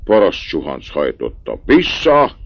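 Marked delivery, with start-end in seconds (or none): 1.6-2.4 very high, loud yell; furious shout. A narrator's reading. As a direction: shout the cart back. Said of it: "kiáltott rá Liszt Ádám".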